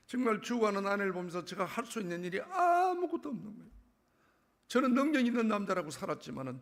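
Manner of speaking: a sermon climb, loud and high; preaching. Real, not acted. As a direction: drive it home to the congregation. Preaching to congregation.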